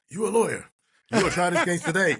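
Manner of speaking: high-pitched raspy judge voice; Judge Frasca. Retold or imitated. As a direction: imitate a raspy judge handing down an order. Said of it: "He had this raspy voice".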